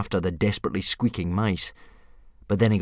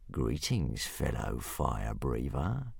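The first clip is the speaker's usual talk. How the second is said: low, soft, slow menacing hiss; a big dragon hissing a threat; hissing hunting dragon; 8 semitones lower, 9 dB quieter, much slower than usual. A narrator's reading. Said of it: "hissed Newt's Breath".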